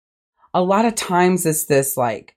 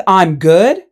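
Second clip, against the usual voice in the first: louder, rising disbelief on good; incredulous echo; 6 dB louder than usual. Real, not acted. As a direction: repeat it back in disbelief.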